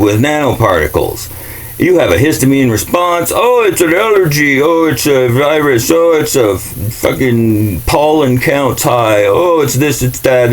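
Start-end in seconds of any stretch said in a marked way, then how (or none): 3.3-10.5 mocking excuses.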